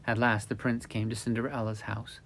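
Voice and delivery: plain reading voice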